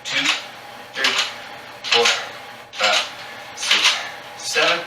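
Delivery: steady rep count, last one pushed up; counting workout reps. Shown as a demonstration. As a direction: call out each rep like a trainer. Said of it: "I'll count 'em out for you".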